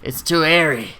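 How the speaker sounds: mocking put-on whinger's voice; caricatured petty complaint; complaining lady. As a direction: imitate a customer griping over nothing. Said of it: "complaining for, like, a good straight ten minutes".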